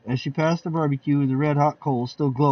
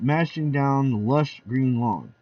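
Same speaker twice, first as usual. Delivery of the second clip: plain reading voice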